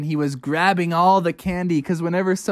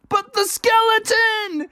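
high, slow alarmed cry; panicked; slower than usual. A narrator's reading. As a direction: point out the danger in panic. Bubby panics.

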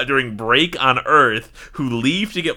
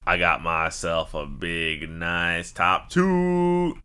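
announcer voice; 10 semitones lower, 4 dB quieter, much slower than usual. Acted. Dramatic segment announcement.

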